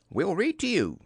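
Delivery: high, cheerful offer; upbeat storybook character voice. A narrator's reading. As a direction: chirp it brightly as a helpful friend. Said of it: "said Rabbit cheerfully".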